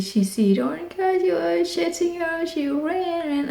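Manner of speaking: excited retelling. Retold or imitated. Worried retelling of chase.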